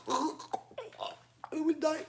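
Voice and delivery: high, slow, soft mock dying moan; drawn-out hammed-up despair; mock agony. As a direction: pretend the straps are killing you. Teacher mimes playing with tight straps.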